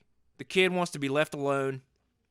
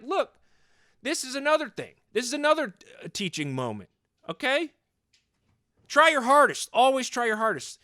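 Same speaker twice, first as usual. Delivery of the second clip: retold yelling; 6 semitones higher than usual. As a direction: shout the lesson like a worked-up dad. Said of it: "Stop screaming at your thirteen-year-old".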